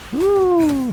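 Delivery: ordinary delivery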